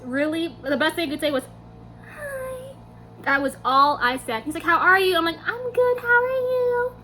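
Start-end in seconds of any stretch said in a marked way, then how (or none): 2.1-2.7 tiny, very high, soft hi; starstruck squeak. Retold or imitated. Replaying her starstruck response. 4.6-5.2 louder, quick, confident question; idol's greeting. Retold or imitated. Quoting the idol, contrasted with herself. 5.4-6.9 very high, strained fan voice; starstruck squeak. Retold or imitated. Acting out her tongue-tied reply.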